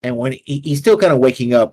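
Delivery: ordinary talk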